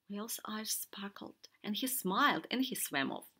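usual speaking voice

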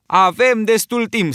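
high, loud, slow retort; Roger shouts. A narrator's reading. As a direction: shout back defiantly. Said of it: "strigă Roger".

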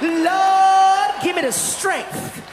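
loud, high, drawn-out cry of Lord; hammed-up prayer for patience; exasperated plea. Woman begs the Lord for strength, loudly exasperated.

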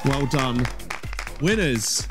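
ordinary talk, nothing marked

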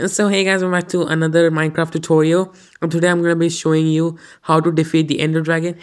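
loud, high, hyped-up tutorial intro voice; mock Minecraft YouTuber. Parodying a Minecraft tutorial channel for his viewers, loud and hyped. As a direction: ham up a cheesy gaming channel opener, laughing into it. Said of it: "I have a brilliant idea of what content I'm gonna make. Minecraft videos".

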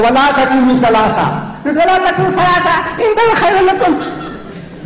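rising, militant recitation; militant proclamation. Shown as a demonstration. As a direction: proclaim it as a ringing command, pitch rising sharply. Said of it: "This is how Allah talks".

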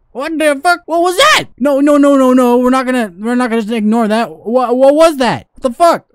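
high, loud panicked outburst; alarmed. Real, not acted. Gamer reacting to something scary at night, loud alarm. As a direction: cry out in startled disbelief.

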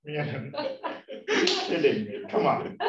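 normal talking voice

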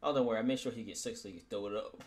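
ordinary talk, nothing marked

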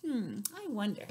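ordinary delivery

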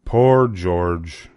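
usual speaking voice

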